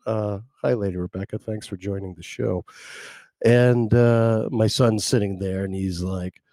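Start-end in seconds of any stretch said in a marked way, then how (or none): none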